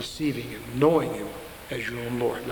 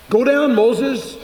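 high, loud, slow command; commanding God; 9 semitones higher, 9 dB louder, much slower than usual. Retold or imitated. God sending Moses, loud and slow. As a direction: boom it out like a divine order.

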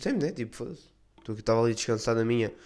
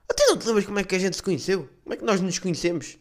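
pressing acquaintance; 8 semitones higher, 4 dB louder than usual. Retold or imitated. Guy presses with questions.

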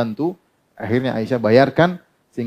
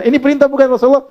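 high, loud retelling of a pointed question; retold question; 12 semitones higher, 6 dB louder, faster than usual. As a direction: ask it pitched right up and loud. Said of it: "Karena Badir bertanya". (bertanya dengan nada tinggi dan lantang)

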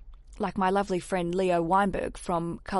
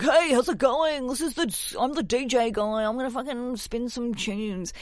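sleazy DJ guy; 4 semitones higher than usual. Retold or imitated. Host mocking sleazy DJs for a bride, hammed-up cheesy DJ voice. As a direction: greet the crowd like a cheesy club DJ. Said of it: "sleazy D Js called Steve, who are like".

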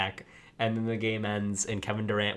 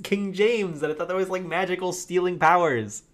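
high, loud baffled complaint; bewildered fan who was misled; confused viewer; 9 semitones higher, 6 dB louder than usual. Retold or imitated. A misled podcast listener after the movie, loud baffled complaint.

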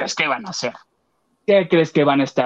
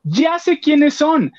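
high, slow "ya sé quiénes son" of discovery; slow, high-pitched aha; realizing; 12 semitones higher, much slower than usual. Retold or imitated. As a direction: drag it out, way up high, as it dawns on you.

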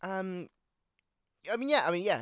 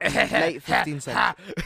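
grand announcer shout; 4 dB louder than usual. Pretend. Mock announcer retake of the line.

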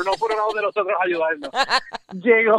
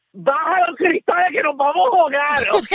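urgent warning; 8 semitones higher than usual. Retold or imitated. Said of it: "yo lo que le digo es".

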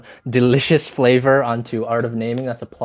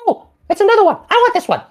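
very high, fast, excited creature voice; high squeaky head voice; 7 dB louder, much faster than usual. Acted. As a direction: squeak it out like a silly giant head. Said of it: "is like".

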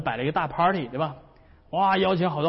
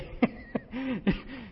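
amused laugh; 9 semitones higher, 6 dB quieter than usual. Real, not acted. Preacher laughs at story.

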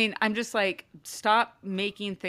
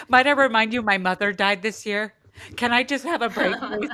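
louder, higher mock whine like a five-year-old; childish martyred plea; 4 semitones higher, 7 dB louder than usual. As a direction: play the put-upon martyr, half-joking, with a laugh at the end. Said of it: "I was like, so like my five-year-old".

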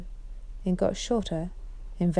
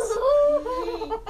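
a bright, high burst of laughter; high delighted laugh. Acted. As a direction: laugh off the nonsense. Caspian laughs at the ghost idea.

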